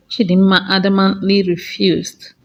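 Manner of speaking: ordinary delivery, nothing marked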